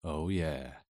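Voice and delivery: light, soft imitation of his Southern drawl; mimicked southern accent. Narrator teasing Stephen by copying his voice: soft Southern accent mimicry. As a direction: echo him back in a soft Southern accent. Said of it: "I mimicked that soft southern accent".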